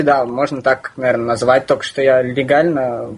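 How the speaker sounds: normal talking voice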